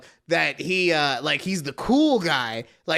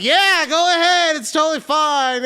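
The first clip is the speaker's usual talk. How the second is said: higher, louder mock encouragement; imitated casual green light; breezy go-ahead; 6 semitones higher, 5 dB louder than usual. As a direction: wave someone on, loud and high. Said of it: "he was just like".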